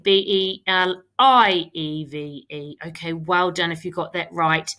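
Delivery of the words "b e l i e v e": letters spelled out slowly; B E L I E V E one letter at a time; spelling out letters. Shown as a demonstration.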